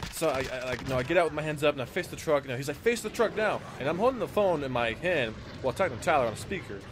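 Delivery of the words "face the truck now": high-pitched mock command; imitated yelled order. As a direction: order him about like the angry truck owner. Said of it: "he's just yelling at me".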